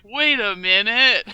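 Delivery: slow dawning wait a minute; slow realization. Retold or imitated. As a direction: let it dawn slowly. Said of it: "he's like".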